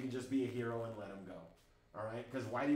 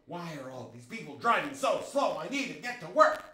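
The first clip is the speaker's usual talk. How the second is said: frustrated speeder; 2 semitones higher, 7 dB louder than usual. Acted.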